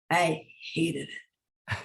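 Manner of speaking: low-key, drawn-out "hated"; quiet, weighty admission; slow, deliberate loathing. Real, not acted. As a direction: say it slowly with a pause before "hated". Dwells on hated fashion job.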